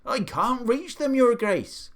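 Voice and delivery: high, loud, protesting call; gardener calling back. A narrator's reading. Gardener up ladder to the Duke, calling back. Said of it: "the gardener called back".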